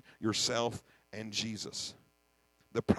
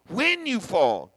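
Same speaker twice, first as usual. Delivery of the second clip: "when you fall" slow, louder, WHEN punched; stress on WHEN; 4 semitones higher, 8 dB louder, much slower than usual. Shown as a demonstration. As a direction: hammer WHEN slowly. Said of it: "not if you fall".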